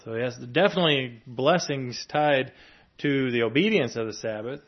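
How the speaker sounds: normal talking voice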